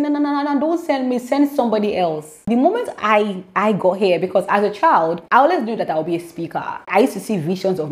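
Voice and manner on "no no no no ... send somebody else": afraid Moses. Acted. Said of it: "But Moses is still afraid".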